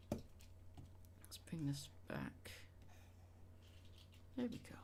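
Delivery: slow whisper to herself; whispered aside. Real, not acted. Streamer talking to herself while fixing the screen, slow whisper. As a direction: mutter it under your breath, slowly. Muttering to self at computer.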